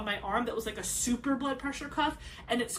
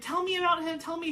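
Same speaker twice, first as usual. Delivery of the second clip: eager pleading; 7 semitones higher than usual. Retold or imitated. Mother begging husband at warmer.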